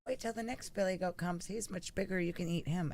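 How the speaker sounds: small, high, soft goat voice; little billy goat. Acted. Little goat talks the troll out of eating him, high voice. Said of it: "the little billy goat says".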